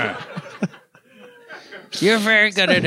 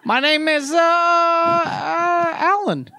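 dummy voice; 8 semitones higher, much slower than usual. Pretend. Dummy character answers.

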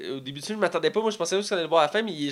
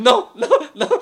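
laughing mock protest; 9 semitones higher, 9 dB louder than usual. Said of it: "Il est comme".

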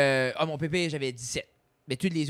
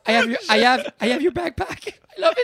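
gushing excitement; 7 semitones higher, 8 dB louder than usual. Pretend. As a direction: squeal it like an overexcited fan. Imitation enthousiaste en anglais. (fan surexcité, voix très aiguë et forte)

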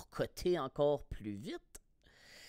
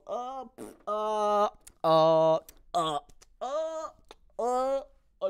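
high, loud yelps of someone tumbling; string of short cries, one per bump; re-enacted pained cries; 8 semitones higher, 8 dB louder, much slower than usual. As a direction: cry out as if taking a fall. Re-enacting his fall at the gym.

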